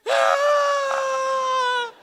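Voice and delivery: high shouted crying wail; mock wail. Bully bursting into tears, high mock wail. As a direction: burst into a loud childish wail.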